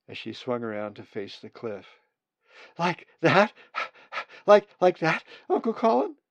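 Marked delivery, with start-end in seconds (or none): 2.8-6.2 put-on much higher and louder, eager child's question; a young bird seeking praise in flight; eager chick. Chick asking uncle mid-flight.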